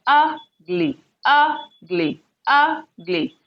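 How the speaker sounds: UGly, UG held, gly dropping; stress on first syllable. Shown as a demonstration. Teacher drilling ugly for learners, first syllable stressed. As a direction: lean on UG in ugly, let gly fall. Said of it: "The stress is here on the first syllable".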